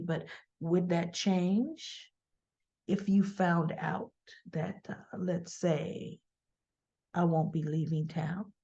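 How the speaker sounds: low, soft, slow insinuation; Audra's sly side-mouth talk. Retold or imitated. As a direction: murmur it slowly, as if from the side of the mouth. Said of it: "like, out of the side of the mouth".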